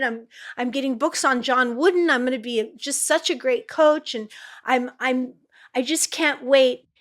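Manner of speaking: bubbling over with naive excitement; excited gushing. Retold or imitated. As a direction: gush like a starry-eyed new coach. Gushing young self retold to Wayne.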